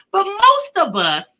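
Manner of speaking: ordinary talk, nothing marked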